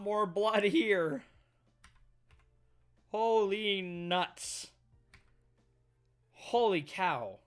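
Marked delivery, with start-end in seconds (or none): none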